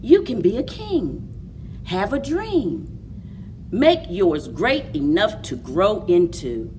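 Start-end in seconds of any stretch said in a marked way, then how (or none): none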